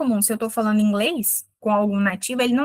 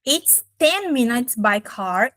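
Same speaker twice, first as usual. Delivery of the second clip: slow example reading; much slower than usual. Shown as a demonstration. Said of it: "apareceu aqui no exemplo".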